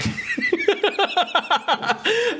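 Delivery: long high-pitched giggling fit; real laugh rolling on at high pitch; helpless laughter. Real, not acted. Streamer laughs off own cope, helpless laughter.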